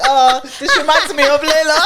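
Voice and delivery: loud, high, laughing through the words. Real, not acted. Friend retelling his remark about Layla, laughing. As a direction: crack up while quoting someone. Quoting him while cracking up.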